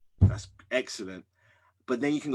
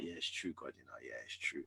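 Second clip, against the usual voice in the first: softer, resigned admission; quiet concession; 10 semitones lower, 17 dB quieter than usual. Retold or imitated. Father humbled, admitting to God quietly and resigned. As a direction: give in under your breath.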